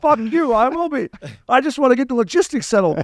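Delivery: high-pitched, defensive mock outburst; retold yelling back. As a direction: imitate a heated, indignant comeback. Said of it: "I'm yelling at each other".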